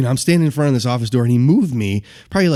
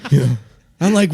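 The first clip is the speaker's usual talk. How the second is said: ordinary talk, nothing marked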